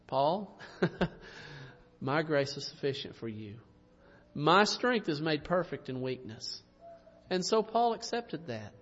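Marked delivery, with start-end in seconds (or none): 0.1-6.6 soft, low, gentle answer; the Lord. Acted. The Lord answering Paul's plea, gently reassuring. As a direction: reassure quietly, voice dropped low with a small chuckle.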